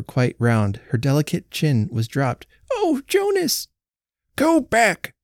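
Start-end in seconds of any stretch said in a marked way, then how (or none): 2.7-3.7 put-on much higher startled cry of an old wife; alarmed old woman. A narrator's reading. 4.3-5.1 put-on higher, sharp order of an old husband; old man's command. A narrator's reading. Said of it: "cried the old man".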